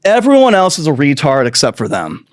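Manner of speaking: ordinary talk, nothing marked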